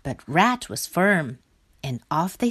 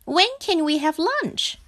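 high little frog asking; high-pitched, wanting character voice; hungry frog voice; 10 semitones higher than usual. A narrator's reading. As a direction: ask for food in a high childlike voice. Frog asks for lunch.

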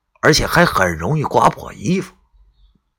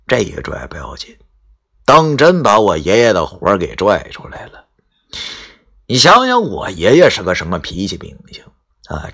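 ordinary delivery

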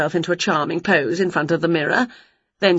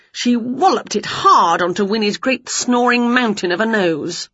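ordinary delivery

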